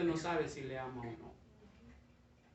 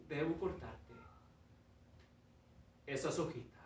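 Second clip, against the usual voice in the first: softer, lower, firm; quiet master; 3 semitones lower, 10 dB quieter than usual. Acted. Master quietly insisting on cutting the leaves. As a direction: say it quietly, no arguing.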